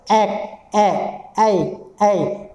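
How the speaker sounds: ए stretched out and repeated; vowel drill. Shown as a demonstration. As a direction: isolate the vowel ए slowly. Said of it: "पीछे आप देखिए".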